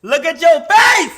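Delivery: loud teasing shout. Real, not acted. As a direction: yell it to tease him.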